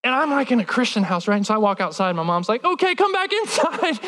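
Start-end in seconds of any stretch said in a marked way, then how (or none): 2.6-4.0 high, anxious mom calling; high-pitched mock mom voice; alarmed mom. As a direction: pitch it up, ushering the child back in. Mom hurriedly calls child back inside.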